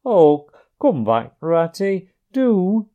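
pleading cry. A narrator's reading. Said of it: "cried the Mole".

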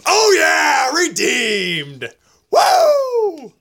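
high, shouted cheer with a whoop; whooping victory cry; triumphant celebration. Real, not acted. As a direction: celebrate a redeemed joke, ending in a soaring woo. Celebrates landing a joke.

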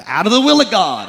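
hammed-up holy indignation; mock preaching outrage. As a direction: preach it like a fire-and-brimstone warning. Mock-preaching about young couples.

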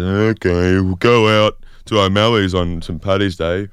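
drawn-out, reluctant mock okay; mildly put-on grumble of consent; grudging agreement. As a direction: imitate housemates giving in, unenthused. Housemates grudgingly agree to outing.

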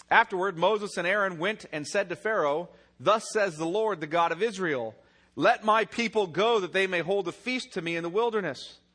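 loud, ringing reading of the verse; proclaiming scripture. Acted. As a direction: proclaim the Lord's words to the crowd. Preacher proclaims scripture loudly.

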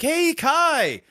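high, bright sing-song hello to his son; sing-song greeting. Retold or imitated. Dad coming home, calling a sing-song hello to his son. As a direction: call out a cheery hi, up high.

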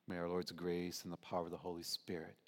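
ordinary talk

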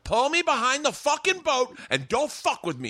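high-pitched stern order; imitating a cross friend; mock anger. Imitating Bobby's angry order.

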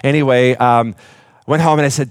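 normal talking voice

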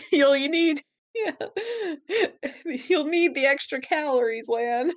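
breaking into laughter mid-line; giggling while quoting; laughing through it. Retold or imitated. Voicing Mega Man, cracking up.

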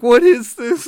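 high, loud shocked cry; startled, near-shrieking question; shocked yelp. Retold or imitated. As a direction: yelp it like a rough tongue caught you off guard. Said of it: "And I was like".

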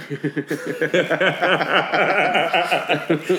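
loud, rising burst of laughter; big laugh. Real, not acted. Host laughing at the guest's Irishman joke, loud rising laugh. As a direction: laugh hard at your own joke. Laughs at his own punchline.

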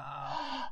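sharp surprised gasp. Real, not acted. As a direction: gasp at the realization. Sudden realization.

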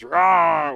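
loud, high monster "rah"; Muppet roar. Retold or imitated. Husband teasing wife as a rampaging Muppet, roaring. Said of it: "knocks everything down and goes".

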